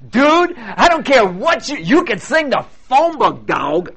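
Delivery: high, loud, laid-back dude voice; Randy impression. Pastor imitates Randy judging Scotty, slow and loud dude voice. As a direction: drawl it out slowly like a cool judge. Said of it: "that's how he talks".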